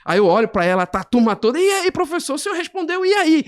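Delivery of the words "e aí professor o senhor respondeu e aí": much higher, eager students demanding an answer. Retold or imitated. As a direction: pitch it up like a class hungry for the answer. Students pressing the teacher.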